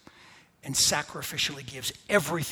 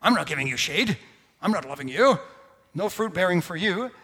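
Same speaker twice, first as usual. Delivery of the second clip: a hint of a snooty tree, a bit louder; petty tree; 2 semitones higher, 7 dB louder than usual. Preacher imagines a tree refusing shade, mock indignant. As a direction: play a huffy tree snubbing someone. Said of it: "tree looking down going".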